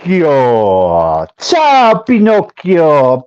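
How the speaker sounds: hammed-up Italian, drawn-out vowels; Italian accent. Showing how the book's title should be said, mock Italian accent. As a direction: stretch it out in a showy Italian accent. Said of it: "say it with an Italian accent".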